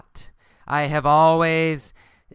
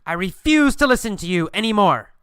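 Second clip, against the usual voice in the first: higher-pitched, indignant refusal; mild acted yell; 6 semitones higher than usual. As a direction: refuse firmly, as in a story quote. End of yelled quote in story.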